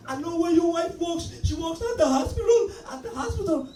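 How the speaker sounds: menacing threat. Retold or imitated. The washer naming the wife's hospital, high and threatening. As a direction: rub in that you know about his wife. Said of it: "And then he said".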